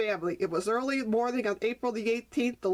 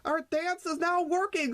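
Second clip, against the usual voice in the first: rejoicing; 7 semitones higher than usual. Acted. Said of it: "Skip rejoiced".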